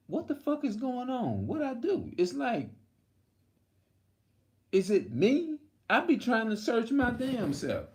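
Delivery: high-pitched, baffled outburst; 누가 문제인지 모르겠다는 듯 높게 터뜨리는 목소리; exasperated inner voice. Retold or imitated. Exasperated self-questioning rant.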